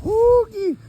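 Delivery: high, loud holler like calling a coon dog. Retold or imitated. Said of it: "He hollering at me like I'm a coon dog".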